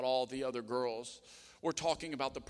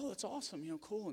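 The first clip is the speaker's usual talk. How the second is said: imitated polite girl; 9 semitones higher, 4 dB quieter than usual.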